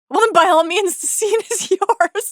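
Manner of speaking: high-pitched quote cracking into laughter; amused quoting. Retold or imitated. Host quoting a favourite comeback from the show, laughing. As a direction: relay the line, laughing as you go.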